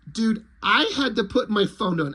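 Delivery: ordinary talk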